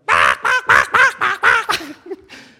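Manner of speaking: very high, very loud imitated crowing; rooster crow. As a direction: crow like an old bird outside the window. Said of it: "this old bird just a crowing and hollering loud".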